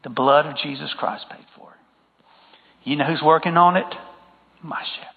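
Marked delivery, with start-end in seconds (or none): 4.6-5.1 dropped to a near whisper; breathy hush of conviction. Acted. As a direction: lean in and hush it. Preacher drops to a hush.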